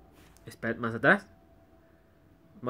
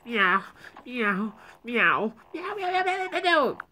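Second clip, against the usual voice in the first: cute anime streamer; 2 semitones higher, 3 dB quieter, much slower than usual. Pretend. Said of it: "Me siento un gatito gordo".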